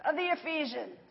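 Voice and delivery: high chanted cry that drops away at the end; mock crowd shout. A narrator's reading. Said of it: "they all shouted in unison".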